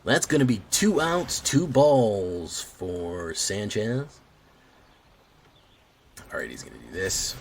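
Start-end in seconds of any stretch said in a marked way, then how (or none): 0.0-4.2 high, very slow play-by-play call; sportscaster voice. Acted. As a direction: drag it out like a baseball announcer. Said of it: "I'm doing an impression of a sportscaster".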